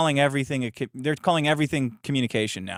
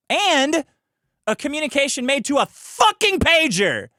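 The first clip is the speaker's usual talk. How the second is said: high, loud, incredulous punch on the last item; exasperated outburst; 11 semitones higher, 4 dB louder than usual. Real, not acted. Streamer reading out the statute's last absurd item, exasperated. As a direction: burst out in disbelief at the list.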